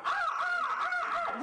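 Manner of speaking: very high, slow drawn-out crow caws; crow alarm caws. Retold or imitated. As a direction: caw like an upset crow on a branch.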